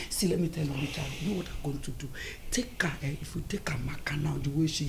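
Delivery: soft whispered plan; conspiratorial whisper. Acted. Mother whispers her plan to her son Richard. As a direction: whisper it like a secret.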